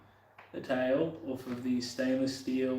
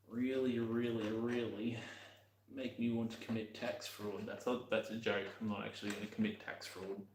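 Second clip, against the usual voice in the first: speaking quietly; 8 dB quieter than usual. Real, not acted. Vlogger joking to viewers about tax fraud, speaking quietly. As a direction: keep it soft, almost to yourself. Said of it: "hope I'm not speaking too quietly".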